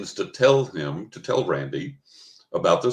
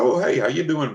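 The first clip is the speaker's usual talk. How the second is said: cheery greeting; 7 semitones higher, 5 dB louder than usual. Retold or imitated. Greeting a friend on the phone.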